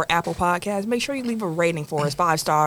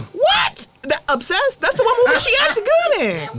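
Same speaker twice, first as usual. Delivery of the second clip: high shocked protest; can't believe what he heard; outraged disbelief; 4 dB louder than usual. Real, not acted. Outraged disagreement.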